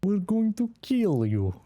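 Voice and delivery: put-on Russian accent. Criminal practising his Russian accent before the robbery. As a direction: try on a Russian villain accent, deliberately. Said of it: "How do I do a Russian accent?".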